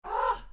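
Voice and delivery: high yelp of strain; sharp cry of effort. Real, not acted. Streamer straining on push-ups, sharp yelp. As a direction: let out a sudden pained yelp.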